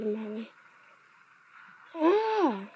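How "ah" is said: high "ah" scream; short high yell of alarm; startled scream. Real, not acted. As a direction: cry out as if hit. Hurt in the game.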